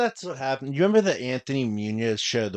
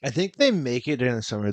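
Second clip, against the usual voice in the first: usual speaking voice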